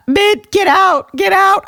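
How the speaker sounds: high, loud pretend exorcism; mock banishing shout. Host ordering the haunting co-host out, mock shout. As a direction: shout it high in play panic.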